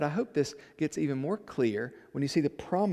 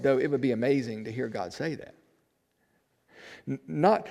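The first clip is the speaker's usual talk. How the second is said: ordinary talk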